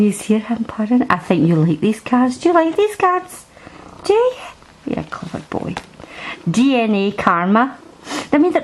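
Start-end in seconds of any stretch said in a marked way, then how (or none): 2.4-4.3 high sing-song question to a cat. Real, not acted. Baby talk to purring cat. 4.9-5.7 soft whisper to the cat; whispered praise. Real, not acted.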